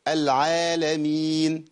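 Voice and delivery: العالمين with the alif drawn out two counts; long natural madd. Shown as a demonstration. Teacher demonstrating correct natural vowel length. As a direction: stretch the aa in العالمين evenly, slowly. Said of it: "أما أقول مثلًا".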